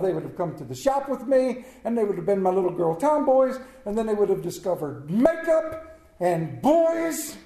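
usual speaking voice

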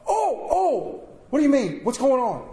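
flustered, high, rushed oh oh; sudden panic. Retold or imitated. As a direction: scramble as it dawns on you. Husband realizing labor started.

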